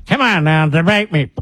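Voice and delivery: mock drunk taunt. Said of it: "They say in between drinks".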